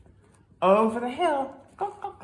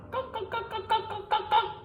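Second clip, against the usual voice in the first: high quick clucks; hen clucking; faster than usual. Acted. Hens cluck under bed.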